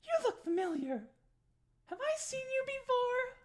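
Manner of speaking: very high, loud, squeaky hostess voice; squeaky, loud Darnsky serving the party; eager barmaid. Acted. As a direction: push the drink on them with bubbly certainty. Darnsky to Luann.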